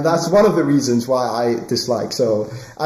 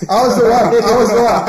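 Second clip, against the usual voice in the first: emphatic; 7 semitones higher, 5 dB louder than usual. Real, not acted. Indignant retelling of quarantine unfairness.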